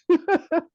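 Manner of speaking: short high-pitched chuckle; laugh. Real, not acted. Storyteller laughing at own childhood answer, short chuckle. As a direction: burst into a brief laugh. Laughs amid retold confusion.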